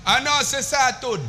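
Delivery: high, slow mock Arabic; broken Arabic. Uncle repeats broken Arabic.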